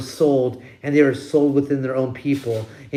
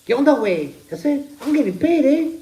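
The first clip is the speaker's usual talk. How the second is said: mock Mexican accent; 10 semitones higher, faster than usual. Imitating a carefree Mexican worker.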